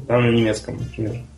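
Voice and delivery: usual speaking voice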